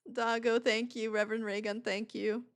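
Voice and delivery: ordinary talk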